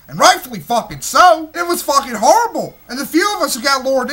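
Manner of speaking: loud, high, furious ranting; angry rant. Real, not acted. Host rants at listeners about a film, loud and furious. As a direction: blow up over the movie.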